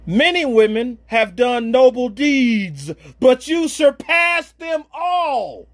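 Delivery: slow, building to a shout; fervent scripture reading. Real, not acted. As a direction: proclaim the verse slowly, rising to a cry. Reading scripture with rising fervour.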